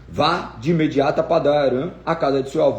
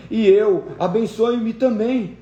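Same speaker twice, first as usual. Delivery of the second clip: higher, slow plea, a touch of a cry; bitter pleading; 7 semitones higher, much slower than usual. A narrator's reading. Esau pleads with his father for a blessing, slow and bitter. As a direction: beg for what is already lost. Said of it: "soltou um forte grito amargurado e suplicou".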